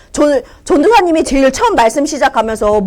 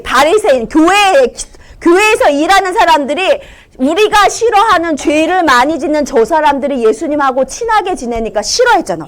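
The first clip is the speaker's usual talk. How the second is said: ordinary talk, nothing marked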